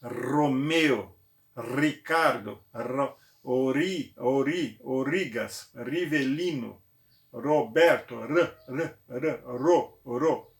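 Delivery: names with a rolled R; trilled R demo. Shown as a demonstration. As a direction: roll every R hard and slow. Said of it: "O som é sempre o som trinado".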